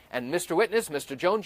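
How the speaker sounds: ordinary talk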